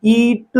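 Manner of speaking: ईट with the ee held, then a light t; long vowel, light t. Shown as a demonstration. Teacher isolating the rime, ईट drawn out with light t. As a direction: stretch ईट slowly and release a small ट. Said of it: "हल्का से".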